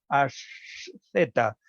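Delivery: asceta drawn out very slowly, S against the teeth; dental S demo. Shown as a demonstration. Lecturer slowly shows the dental S in asceta. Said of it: "esa S ya no se articula en alveolar".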